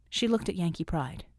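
ordinary delivery, nothing marked

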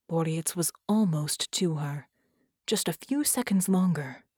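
usual speaking voice

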